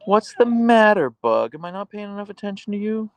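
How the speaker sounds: high-pitched doting dog talk; pet-talk voice. Real, not acted. Owner asks the dog what's the matter, high pet-talk voice.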